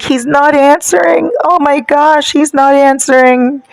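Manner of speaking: high, whiny mock panic; put-on upset wail; imitated distress. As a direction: mimic her fretting, pitched right up. Mimics upset woman panicking.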